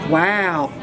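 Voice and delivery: plain reading voice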